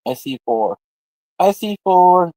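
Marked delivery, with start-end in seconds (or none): 1.4-2.3 louder, drawn-out call; emphatic repeat. Real, not acted. Repeating the count of four, louder and drawn out.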